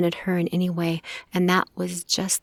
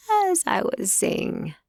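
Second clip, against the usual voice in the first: measured; 12 semitones higher, slower than usual. A narrator's reading. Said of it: "in the same measured tone of voice".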